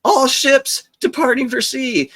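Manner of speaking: high siren whoops, then a slow PA announcement; imitated ship alarm. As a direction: mimic the ship's alarm and loudspeaker call. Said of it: "they were like".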